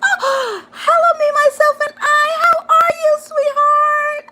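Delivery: very high, sung-out hello; high sing-song greeting. Acted. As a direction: greet them in a bright falsetto. Sing-song self-greeting to viewers.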